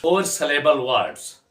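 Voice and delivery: usual speaking voice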